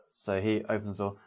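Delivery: normal talking voice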